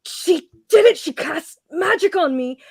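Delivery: high, loud flustered outburst; blurting the accusation in anger; desperate angry shout. Acted. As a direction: yell it out, red-faced and cornered. Sardine humiliated, accusing.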